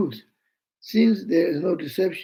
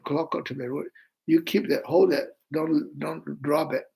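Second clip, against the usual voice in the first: usual speaking voice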